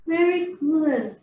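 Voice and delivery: normal talking voice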